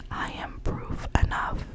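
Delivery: ordinary delivery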